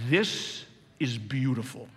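soft, low, slow New York driver with a laugh; hint of the horse-carriage man, softly. Preacher imitating the carriage driver, soft and slow. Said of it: "exactly what he said".

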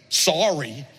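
dismissive, offhand sorry; cheap sorry. Shown as a demonstration. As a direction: toss it off without owning it. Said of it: "David just goes".

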